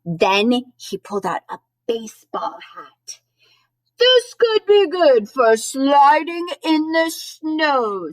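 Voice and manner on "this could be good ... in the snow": high, loud, stretched-out Santa; drawn-out, delighted storybook Santa; Santa's voice. A narrator's reading. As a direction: read Santa big and slow, pitched up. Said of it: "Santa said".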